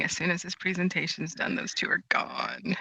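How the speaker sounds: whispering. Pretend. Voicing Ken's hidden thoughts.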